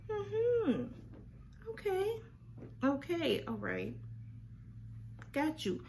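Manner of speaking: high knowing "mm-hmm, okay"; sarcastic. Retold or imitated. Replying to the liar, high and sarcastic. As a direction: play along, dripping doubt.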